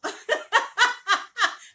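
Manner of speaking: loud, high peal of laughter; laughing. Real, not acted. Laughing at own rising emotion.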